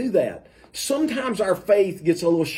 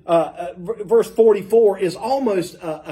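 ordinary talk

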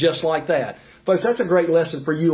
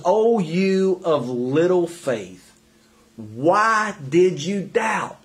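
drawn out, each word given weight; slow, gentle rebuke; much slower than usual. Retold or imitated. Jesus slowly asking Peter why he doubted, drawn-out rebuke. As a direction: let Jesus' question come slowly. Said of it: "what he actually said to him was".

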